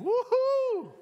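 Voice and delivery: high, whooping cheer; high-pitched playful woohoo; mock exhilaration. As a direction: let out an acted burst of energy. Acts waking up full of energy.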